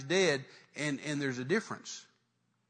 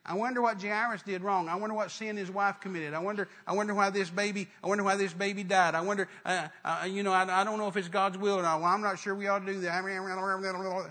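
mock chattering crowd, droning on one raised pitch; gossiping doubters; 3 semitones higher than usual. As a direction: run the doubts together like idle gossip. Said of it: "crowd of people saying".

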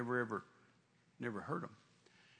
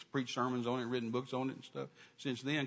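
ordinary delivery

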